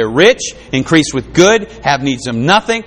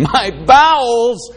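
long, drawn-out high wail of anguish; anguished Jeremiah; 8 semitones higher, much slower than usual. Acted. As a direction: cry it out slowly as the tormented prophet, pitched high. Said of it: "what does Jeremiah feel about that".

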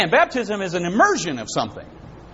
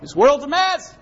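much higher, calling out repent; high prophet's call to repent; John the Baptist; 9 semitones higher, slower than usual. Acted. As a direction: cry repent like a prophet in the wilderness. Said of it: "remember what he said".

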